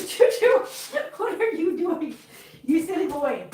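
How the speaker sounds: high, sing-song coo to a pet. Real, not acted. Talking to her cat.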